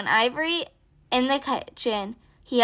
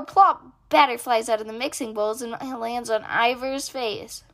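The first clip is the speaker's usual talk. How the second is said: ordinary delivery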